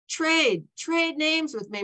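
put-on mildly insistent child's demand; eager urging. Girl urges boy to swap.

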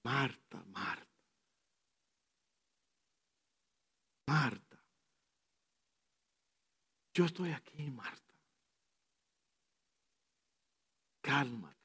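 calm her tenderly; gentle voice of God. Retold or imitated. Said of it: "le dice". (Dios le habla a Marta, muy suave y tierno)

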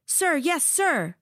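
high barked sir yes sir; barked military recruit reply. A narrator's reading. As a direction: bark it back like a recruit. Recruits answer in chorus.

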